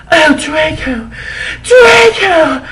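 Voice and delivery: high, loud mock moaning cry; hammed-up scream. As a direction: overact a breathless cry. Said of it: "I screamed while getting an orgasm".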